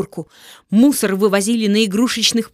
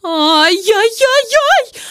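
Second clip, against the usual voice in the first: high, slow wailing shriek; witch's howl; 11 semitones higher, 5 dB louder, much slower than usual. Acted. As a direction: howl as she swells up. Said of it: "завопила Неуберинда".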